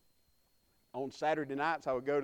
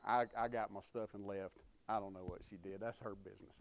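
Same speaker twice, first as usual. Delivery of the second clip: soft, lower, dropping away; hushed aside; 7 semitones lower, 8 dB quieter than usual. Real, not acted. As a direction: say it under your breath, amused. Softer aside after the punchline.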